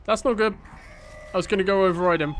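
high, loud panicked yelp; alarmed shout. Real, not acted. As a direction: blurt it out in sudden alarm, fast. Enemies attack, sudden alarm.

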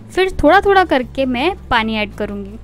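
ordinary talk, nothing marked

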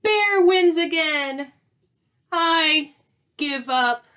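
high, loud, slow lament easing down; dramatic storybook admission of defeat; defeated wail. Acted. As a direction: wail your surrender. Defeated skunk wails and surrenders.

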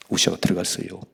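normal talking voice

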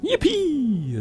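high excited yip; gleeful squeal. Real, not acted. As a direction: squeal with delight. Excited at buying supplies.

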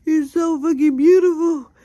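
building to a loud high shout; escalating mirror pep talk, shouting; hyped self-praise. Pretend. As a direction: pump yourself up, then yell it. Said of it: "just really be like".

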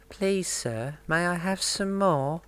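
lightly voiced pleading orphan; mock Oliver. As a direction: beg like a hammy child actor. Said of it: "That's what he sounded like".

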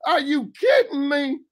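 shocked, as if you can't believe it; shocked disbelief. Retold or imitated. Isaac, voiced by the teacher, reacting to Esau's return in shocked disbelief. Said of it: "I'm saying".

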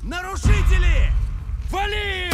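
loud, high war cry; battle shout. Acted. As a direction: roar the command at intruders. Combat orders shouted.

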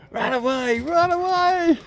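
high excited yell for the dogs; egging them on, rising; excited cheer. Real, not acted.

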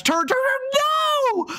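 high-pitched squeal of alarm; frantic, pitch shooting way up; panicked cry. Real, not acted. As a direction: cry out as the crash comes. Losing control before a crash.

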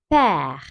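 "père" with a wide open French è, held long. Shown as a demonstration. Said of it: "E'yi açıyoruz artık". ("père" açık E ile)

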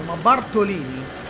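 normal talking voice

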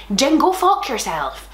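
usual speaking voice

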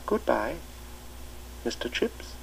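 soft, fading remembered goodbye; words echoing in his mind; echoing memory. A narrator's reading. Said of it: "words echoing along the corridors".

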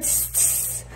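sharp hissed water-on-hot-iron noise; short sibilant sizzle; imitated sizzle. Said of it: "and I heard".

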